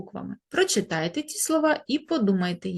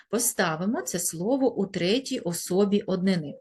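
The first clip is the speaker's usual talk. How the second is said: normal talking voice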